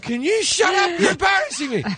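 high strained squawk, retold; mock-scold in a squeaky high voice; high exasperated scolding. Retold telling-off of embarrassing partner.

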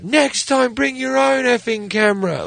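high-pitched mock yell; tearful-exit yell, retold. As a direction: yell it as a girlfriend storming off, no great volume. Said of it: "yells out".

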